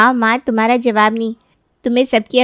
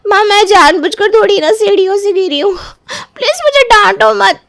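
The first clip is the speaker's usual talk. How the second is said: higher, hurt plea to stop the scolding; pleading daughter; 10 semitones higher than usual. Acted. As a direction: beg her mother not to scold. Daughter begs mother stop scolding.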